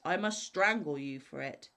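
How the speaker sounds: loud, menacing cry; the spirit loudly threatening the scholar. A narrator's reading. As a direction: call it down on him loudly. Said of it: "cried the spirit".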